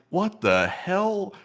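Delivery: high, very slow, drawn-out incredulous question; puzzled disbelief. Acted. Cuba hearing Maduro sing, slow high disbelief. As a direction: stretch it out in disbelief.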